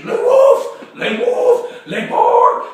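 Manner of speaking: loud, explosive comic woofs; hammed-up barking; barking dog. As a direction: bark out the words like a crazed big dog.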